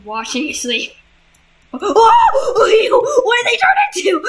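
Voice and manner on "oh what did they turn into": high, loud startled cry; shocked shriek. Real, not acted. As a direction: yelp in real shock. Shocked by monster transformation.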